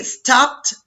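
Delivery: STOPPED as one syllable closing on a little T; small T ending. Shown as a demonstration. Teacher showing students the small T, STOPPED ending in 'pt'. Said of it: "escuchamos una pequeña T".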